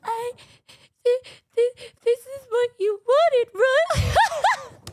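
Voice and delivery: high, loud, stammering sheepish question; high shy friend impression. Friend asking about the pillow in high stammering voice. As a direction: play her nervous stammer. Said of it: "She's like".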